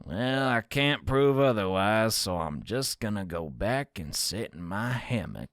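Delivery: put-on amateur cowboy accent, sinking low at the end. Cowboy officer giving up the argument, cowboy accent. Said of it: "My cowboy accent sucks".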